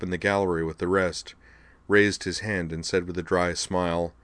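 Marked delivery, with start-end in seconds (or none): none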